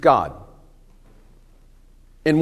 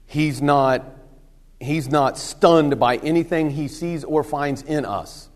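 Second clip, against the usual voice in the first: usual speaking voice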